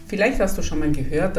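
ordinary delivery

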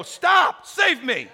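high, loud shout to flag down a car; calling for help. Acted. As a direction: cry out to be rescued. Acts flagging down a car for rescue.

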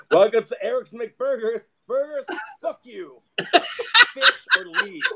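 high, slow and loud, hammed-up surly greeting; mock rude cashier. Cashier at his joke burger place to customers, hammed-up rude welcome. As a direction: sneer the welcome, then yell the order. Hostile fast food greeter.

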